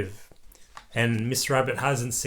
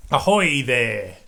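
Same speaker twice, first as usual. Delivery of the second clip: slow, louder, hammed-up sailor call; sailor's ahoy; 5 dB louder, slower than usual. Dad playing a boat captain for his child. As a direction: call out like an old sea captain. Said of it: "and boat".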